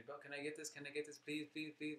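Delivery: put-on repetitive, high little-kid pleading. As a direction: pester like a kid wanting a toy. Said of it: "like a little kid wanting".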